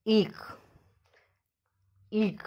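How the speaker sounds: eek whispered, unvoiced and soft; whispered demo. Shown as a demonstration. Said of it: "I K is eek".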